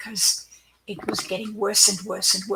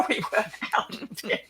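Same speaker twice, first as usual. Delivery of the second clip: laughing; 5 semitones higher than usual. Real, not acted. Laughing at the escape.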